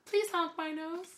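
high pleading clown. Pretend. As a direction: coax like a silly clown. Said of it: "what's wrong with my clown voice?".

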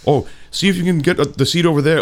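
a hint of an eager do-gooder pointing to a seat; imitating helpful chaperone.

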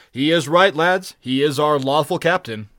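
high, loud call to the crew; loud, eager endorsement; rallying cry. A narrator's reading. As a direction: win the lads over to the captain. Pirate rallies crew to the captain.

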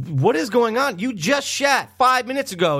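high-pitched incredulous outburst; exasperated disbelief. Retold or imitated. Son confronts dad in the car, exasperated. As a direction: demand an answer in retold disbelief.

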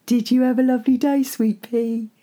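put-on high, cooing voice to a baby; baby talk. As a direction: coo to the baby. Father to baby.

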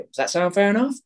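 "Does that sound fair enough?" rising high on "enough"; rising tonality. Shown as a demonstration. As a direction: end on an inviting upturn. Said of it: "you have to say it in that tonality".

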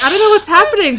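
loud, high-pitched pretend fluster; mock flustered. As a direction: play giddy bewilderment, voice shooting up. Voices own imagined flustered reaction.

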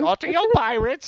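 high, growly salty pirate; gruff old fisherman. Retold or imitated. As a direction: ham up a crusty old sea dog. Quoting ride line in voice.

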